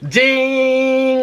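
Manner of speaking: a high, loud "ding" like a bell going off; mock ding. The moment she realizes who the woman is, a high mock bell ding. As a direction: play the lightbulb moment as a bell. Lightbulb moment in her head.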